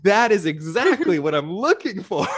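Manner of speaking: thrilled and cracking up; excited, laughing agreement. Real, not acted. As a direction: burst out laughing as you agree emphatically. Delighted agreement about a favourite movie.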